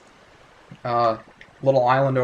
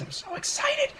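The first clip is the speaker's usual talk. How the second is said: excited squeal; 5 dB quieter than usual. Pretend. Squealing over sailing.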